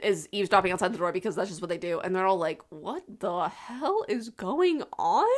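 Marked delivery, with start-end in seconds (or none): 2.7-5.3 slow, drawn-out disbelief; mock bewilderment. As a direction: stretch out each word, totally baffled. Retelling eavesdropping family's bewildered reaction.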